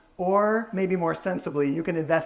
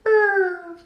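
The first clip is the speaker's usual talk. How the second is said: high plaintive trilling brr call; plaintive high lemur call; lemur lost call; 5 dB louder than usual. Shown as a demonstration. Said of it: "a plaintive lost call that sounds like this".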